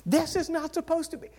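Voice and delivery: high-pitched mock wail, loud first word; unhappy bride. Bride showing pastor her hair, mock distraught. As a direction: imitate an upset bride, very high. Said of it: "not happy".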